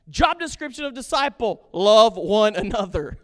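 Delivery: loud preacher punchline; emphatic answer. Real, not acted. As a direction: land love one another hard. Preacher answers own question.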